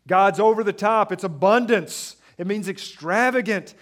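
very loud, high, slowing pulpit peak; preaching at full force. Acted. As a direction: shout God's abundance over the congregation. Preaching God's generosity.

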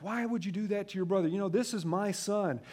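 high, loud stern telling-off; father scolding daughter, slowing on the last line; angry dad scolding. Retold or imitated. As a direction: scold her like a dad who thinks she hit his son. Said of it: "I'm like".

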